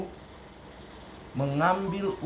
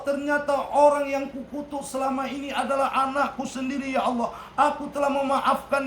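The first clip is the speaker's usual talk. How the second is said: mother's anguished prayer; 11 semitones higher than usual. Acted. Mother crying out to God after her son confesses. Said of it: "ibunya pun langsung berkata".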